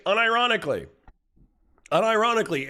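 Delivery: emphatic. Real, not acted. Streamer insists to chat, pitched high. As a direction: hit unironically high and hard.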